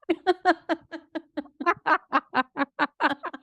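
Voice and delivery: loud high burst of laughter; laughing. Real, not acted. Host laughing at her own mix-up, bright burst of laughter. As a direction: crack up at your own slip.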